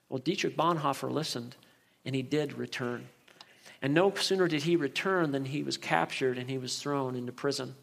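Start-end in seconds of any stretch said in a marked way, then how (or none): none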